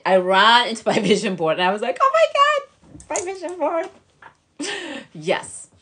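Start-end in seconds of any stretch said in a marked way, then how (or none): 2.0-3.9 high-pitched retold astonishment; excited squeal. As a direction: gasp it out in amazed delight. Retells running to her vision board.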